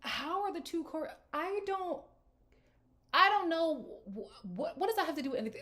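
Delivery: high-pitched incredulous stammer; baffled. Real, not acted. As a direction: sputter in confused disbelief. Baffled by his demand.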